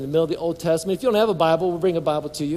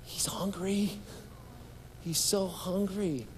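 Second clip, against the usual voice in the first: soft, higher, near-whispered aside; whispery, drawn-out inner voice; hushed realization; 4 semitones higher, 10 dB quieter, slower than usual. Retold or imitated. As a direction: recall the thought in a wondering hush. Retells his hushed inner realization.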